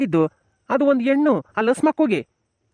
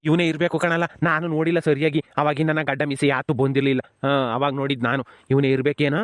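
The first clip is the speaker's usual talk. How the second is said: a lower, gruff village man, unsure who he sees; 7 semitones lower than usual. Acted. Villager peers at the crying son, low and puzzled. Second villager figure, deep voice.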